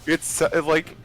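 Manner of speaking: normal talking voice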